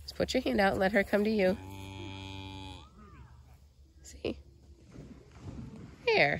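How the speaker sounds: ordinary talk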